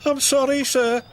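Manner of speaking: high, small, contrite voice; mock whisper that stays voiced; meek apology. A narrator's reading. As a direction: apologise timidly, pitched high. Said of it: "Cranky whispered".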